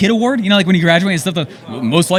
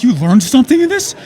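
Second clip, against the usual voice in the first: incredulous question; 7 semitones higher, 3 dB louder than usual. Retold or imitated.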